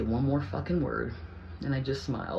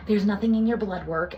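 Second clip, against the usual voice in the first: higher, louder mocking imitation; mocking the doctor; 8 semitones higher, 6 dB louder than usual. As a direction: throw the doctor's verdict back, mocking. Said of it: "she's like".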